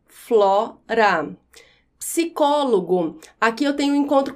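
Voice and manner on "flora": "flora" split into flo, ra, pitched low; syllable by syllable. Shown as a demonstration. Teacher dividing another example word, syllable by syllable. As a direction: pause between the two syllables of "flora". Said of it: "Mesmo acontece em flora".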